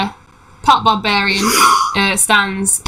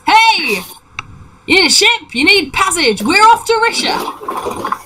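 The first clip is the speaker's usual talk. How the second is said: high-pitched, eager hail; halfling calling down; 10 semitones higher than usual. Acted. Halfling pirate hailing travelers from the ship, high-pitched call. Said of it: "leans down and says".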